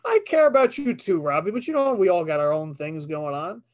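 hammed-up defensive affection; mock placating. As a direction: placate a pretend needy partner. Placating the mock upset girlfriend.

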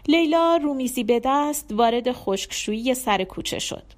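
plain reading voice

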